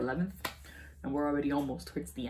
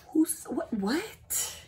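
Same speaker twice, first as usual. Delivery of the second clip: shocked; 11 semitones higher, much slower than usual. Retold or imitated. Retold shock.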